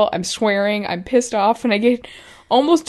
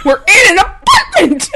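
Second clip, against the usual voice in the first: laughing shout; 9 semitones higher, 8 dB louder than usual. Real, not acted.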